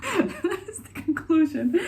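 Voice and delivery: high, loud, laughed-out words; laughing. Real, not acted. Vlogger joking to viewers about her short conclusion, laughing. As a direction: say it through a burst of laughter.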